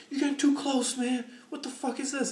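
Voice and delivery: hammed-up street quarrel; angry retort. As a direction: yell back like the offended driver. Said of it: "He's like".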